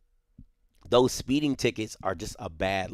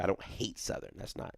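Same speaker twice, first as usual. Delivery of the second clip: near whisper; 4 semitones lower, 14 dB quieter than usual. Real, not acted. Repeating his denial, near whisper.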